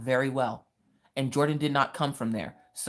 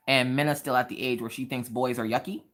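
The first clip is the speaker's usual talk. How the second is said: normal talking voice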